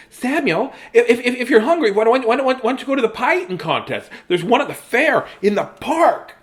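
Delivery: put-on high, stammering kid voice; rushed little kid blurting it out; excited little brother. Little brother runs outside calling to Samuel, high and excited.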